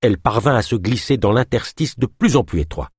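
ordinary delivery, nothing marked

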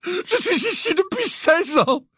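high, slow, tear-choked plea; tearful pleading. A narrator's reading. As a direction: beg through tears. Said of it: "des larmes ruisselant".

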